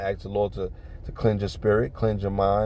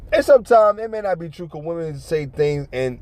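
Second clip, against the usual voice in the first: ordinary talk, nothing marked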